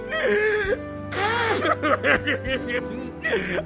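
high tearful sob; sobbing wail. Acted. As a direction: let out a wailing sob. Kalman sobbing to the Rebbe.

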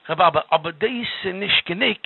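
ordinary delivery

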